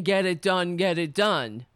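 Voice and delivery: ordinary talk, nothing marked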